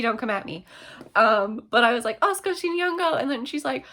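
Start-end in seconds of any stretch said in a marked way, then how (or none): 2.2-3.2 "Skoshi nihongo" pitched up high; eager Japanese attempt. Re-enacting saying it to the woman.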